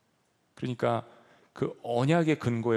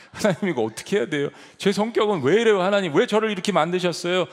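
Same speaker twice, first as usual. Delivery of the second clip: louder, half-laughing grumble at God; complaining prayer; 7 semitones higher, 7 dB louder than usual. Shown as a demonstration. Preacher acting out a complaining prayer, louder and laughing. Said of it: "막 불평도 해보세요".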